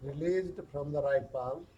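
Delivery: very slow, hushed body-scan voice with long pauses; guided meditation. Acted. Yoga teacher guiding stress down the arms, slow guided meditation.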